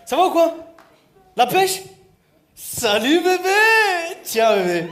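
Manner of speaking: boisterous shouted greeting; loud call-out. Acted. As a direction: holler it out to the crowd. Calling out to the audience, handing out.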